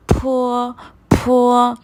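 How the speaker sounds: फो pushed out with a puff of air; slow, emphatic aspirated फो. Shown as a demonstration. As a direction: model फो so air hits a paper. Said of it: "हवा आनी चाहिए होंठों से".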